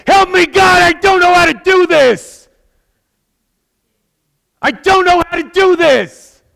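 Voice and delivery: imitating a man crying out across a silent room; desperate shout. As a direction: cry out to God at the top of your lungs, lost and begging. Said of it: "and it's gonna get loud".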